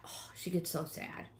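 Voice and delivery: usual speaking voice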